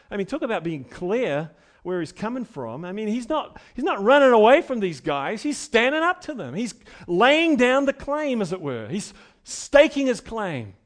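loud, building sermon delivery; rising pulpit intensity; full-force preaching. Acted. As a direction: preach it out to the congregation.

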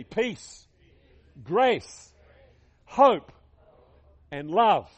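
each word spoken slowly, separately and firmly; chanting a list with the crowd; call-and-response recitation. Shown as a demonstration. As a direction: lead the congregation word by word. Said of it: "Say it with me".

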